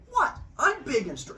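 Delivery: high, affronted protest; indignant boasting. Acted. Young god to doubting girlfriend, mock indignant.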